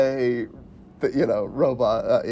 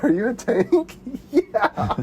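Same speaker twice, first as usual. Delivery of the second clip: high, silly back-and-forth quote; goofy twenty questions; 10 semitones higher, slower than usual. Retold or imitated. Retelling the twenty questions scene, high goofy voices. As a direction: play both dopey friends, pitched up. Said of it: "so quotable".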